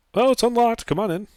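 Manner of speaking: high woman's voice called out. Acted. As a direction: call out lightly from inside. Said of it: "a woman's voice inside".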